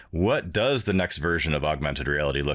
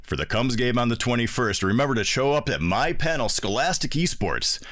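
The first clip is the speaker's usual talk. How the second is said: upbeat, higher radio announcer promo; 3 semitones higher than usual. Acted. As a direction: sell it like an old-school radio DJ. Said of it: "I used to put on what they call the radio voice".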